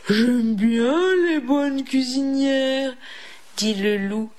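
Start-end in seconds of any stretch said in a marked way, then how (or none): 0.1-2.9 greedy, slavering storybook wolf; drooling wolf. A narrator's reading. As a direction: relish it like a ravenous wolf. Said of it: "l'écume aux babines".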